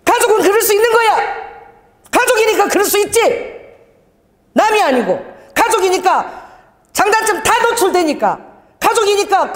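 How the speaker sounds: high, loud, firm declaration; loud insistence. Retold or imitated. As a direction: drown him out with a big-voiced lecture. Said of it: "내가 더 큰 소리로 막".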